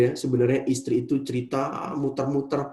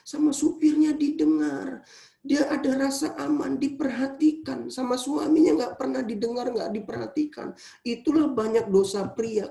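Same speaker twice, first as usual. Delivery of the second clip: high, loud fervent preaching; preaching at full force; 11 semitones higher, 4 dB louder than usual. Acted. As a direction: drive the point home to the congregation. (khotbah berapi-api dengan suara tinggi dan keras)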